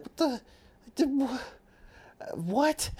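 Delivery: very high-pitched, stammering confusion; acted bafflement. Host re-enacting childhood confusion at British soap, mock bafflement. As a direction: play up being utterly lost for words. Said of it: "my parents would laugh, and I'd be like".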